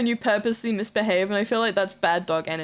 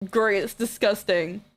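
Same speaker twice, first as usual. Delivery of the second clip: ordinary talk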